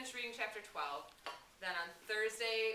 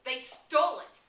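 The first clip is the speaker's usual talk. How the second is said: higher, much louder shout of rage; enraged shout; 3 semitones higher, 11 dB louder, slower than usual. A narrator's reading. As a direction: yell it out in fury. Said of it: "Rage shrilled his voice".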